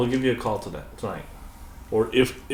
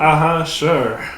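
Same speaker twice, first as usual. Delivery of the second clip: slow, drawn-out disbelieving uh-huh; sarcastic; 7 semitones higher, 9 dB louder, much slower than usual. Acted. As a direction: doubt every word. Nurse's disbelief.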